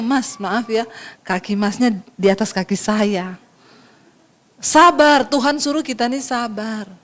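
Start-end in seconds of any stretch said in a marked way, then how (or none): 0.0-3.4 soft, low apologetic request; patient Solo person. Acted. Solo person apologising to the foot-treader, soft and low. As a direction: excuse yourself meekly, voice kept down. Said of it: "orang Solo begitu".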